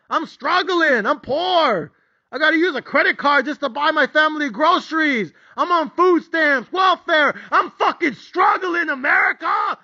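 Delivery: high, loud, crying rant, slowing on key lines; desperate pleading. Retold or imitated. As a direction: beg like the whole world owes you. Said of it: "my raw, honest, legitimate emotions".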